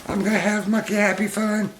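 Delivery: very high cartoon monkey voice; high squeaky pet voice, proud. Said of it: "He said".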